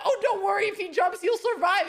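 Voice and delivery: high, loud, breezy mimicry of a friend; mock carefree reassurance. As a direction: toss it off cheerfully, way up high. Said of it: "you're like".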